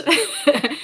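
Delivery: a quick bright laugh; excited laugh. Real, not acted.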